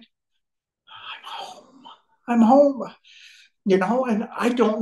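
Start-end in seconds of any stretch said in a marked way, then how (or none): 0.0-1.8 barely voiced, overcome with emotion; choked whisper. Real, not acted. Man overcome recalling arriving home, choked whisper. 2.3-2.9 high, voiced and full of feeling; emotional cry. Real, not acted. Man overcome recalling arriving home, emotional cry. Repeats it aloud after whispering it.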